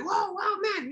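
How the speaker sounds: reenacting an excited kid, very high-pitched; high, amazed fan reaction; wowed, voice shooting way up; excited awe. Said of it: "I was excited too".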